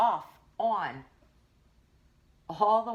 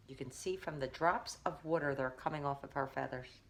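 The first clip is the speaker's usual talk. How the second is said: plain reading voice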